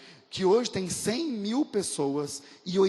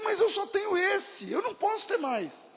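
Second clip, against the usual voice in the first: pleading mother; 14 semitones higher than usual. Retold or imitated.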